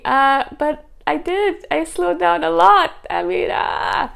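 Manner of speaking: high, slow, exasperated inner voice; baffled protest. Retold or imitated. To herself, confused by viewers asking her to slow down, pitched high and slowed. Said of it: "I'm sitting there thinking".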